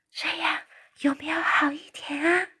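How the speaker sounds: breathy whisper, barely voiced; only breath, no voice. Sophie whispers into the giant's ear, barely audible. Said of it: "苏菲试着只用气声说话".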